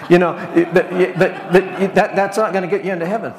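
high, laughing through the words. Real, not acted. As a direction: chuckle as you push the point. Laughing at his own joke.

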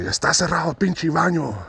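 high mock yell in Spanish; imitating yelling janitor. Cleaner yelling the bathroom is closed, high mock yell. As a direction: squeak out the angry cleaner's Spanish. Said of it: "And he's like".

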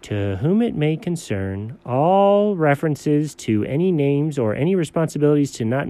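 low, measured legal recital; low-pitched official reading; formal document reading. Acted. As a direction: read out the fine print solemnly. Reads a legal document aloud.

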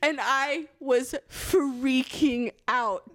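FREAKING stretched out slowly; slow, emphatic "freaking out"; drawn-out emphasis. Real, not acted. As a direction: lean long and slow on "freaking". Emphasizing how bad it was.

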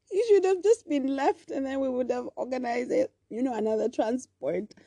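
exasperated mom. Retold or imitated. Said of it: "and I was like".

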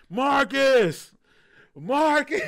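high, loud searching holler; hammed-up shouted name, breaking into laughter; calling a lost puppy. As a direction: call out into the night for a lost dog. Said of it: "Sound like I'm looking for a lost pu-, puppy".

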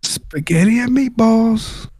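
high, slow mock hawker call; sung-out street-vendor pitch; imitating a vendor's advertising cry. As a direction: stretch the words out like a stadium seller. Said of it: "I haven't heard this good advertising".

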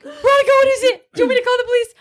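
high, loud alarmed friend voice; imitating alarmed friend. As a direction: call out her name in alarm, high.

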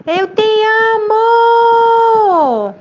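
high, loud, long-held call; father's loud shout. A narrator's reading. Father shouting love for the echo to return, long high call. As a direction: shout it out to the whole mountain. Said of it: "de novo gritou".

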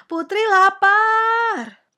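high, loud, stretched call to the kitchen; shouting. A narrator's reading. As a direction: yell it out while rushing off. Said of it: "teriak Ibu Pelayan".

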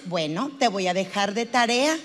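composed counsellor closing the session; psychologist wrapping up. Acted. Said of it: "Si acaba la hora y te dice".

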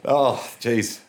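giggling through the words; can't stop laughing; laughing hard. Real, not acted. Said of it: "I need to compose myself after that".

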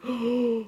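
a quick shocked intake of breath; sharp gasp. Retold or imitated. Staring at the cup, a shocked gasp. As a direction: gasp at what you see.

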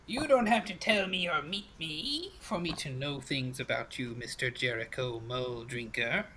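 knowing fortune-teller crone with a swooping lilt; crackly crone. Acted. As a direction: tease him with what you know, as an old witch. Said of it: "her voice crackles like dry weeds".

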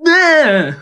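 high squeaky alien yelp; Greedo death cry. Retold or imitated. His dad's favorite, Greedo's death squeak. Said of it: "Then the Greedo one".

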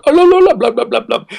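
high, loud mock babble; hammed-up fake tongues, way up high. Mocking man parodies praying in tongues, high loud babble. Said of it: "making fun of it".

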